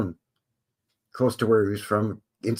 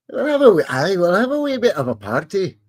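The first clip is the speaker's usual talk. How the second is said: high, hammed-up Scottish brogue; put-on Scottish lilt; mock Scottish accent; 8 semitones higher, 3 dB louder than usual. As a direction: play a merry Scot, pitched high. Putting on Scottish brogue for party.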